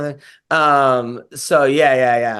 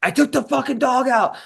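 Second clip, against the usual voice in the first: pitched up, only half yelled; retold yell at wife; 7 semitones higher than usual. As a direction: replay the snap back at your wife. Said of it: "I yelled last week at her".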